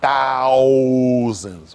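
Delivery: THOUSANDS stretched very long and loud; stress on thousands. Real, not acted. Comic hammers home the war death count, "thousands" loudly drawn out. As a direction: hold the first vowel of "thousands" in a loud swell.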